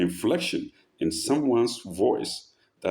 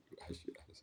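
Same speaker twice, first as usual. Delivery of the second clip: whisper; 26 dB quieter, much faster than usual. Real, not acted. Teasing aside, unvoiced.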